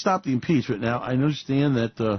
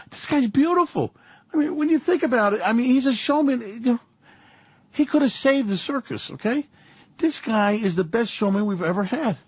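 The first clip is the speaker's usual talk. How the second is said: mock gushing; 8 semitones higher than usual. Mock gushing praise of showman.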